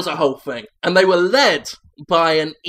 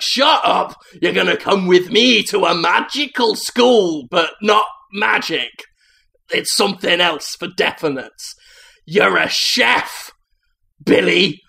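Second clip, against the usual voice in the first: big man voice; 3 semitones higher, 4 dB louder than usual. Acted. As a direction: announce his destiny with a loud boom. Giant tells boy he's chef.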